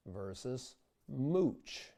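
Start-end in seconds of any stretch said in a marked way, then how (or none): 1.1-1.9 slow mooch with a u glide; English mooch. Shown as a demonstration. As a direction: mooch drawn out with a gliding u. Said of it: "hear the diphthong in mooch".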